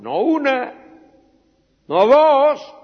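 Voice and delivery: high, loud counting out; indignant emphasis. Acted. Accusers tell the king how often Daniel prays, loud. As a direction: hammer each number.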